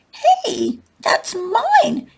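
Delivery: very high indignant cry; child calling out after his stolen apple; shouting. A narrator's reading. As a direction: protest from the floor, high and sharp. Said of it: "shouts Schubert from the floor".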